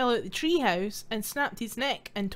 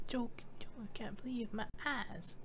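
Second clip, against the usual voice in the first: breathy hushed whisper; 13 dB quieter than usual. Real, not acted. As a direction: whisper it under your breath. Whispered aside.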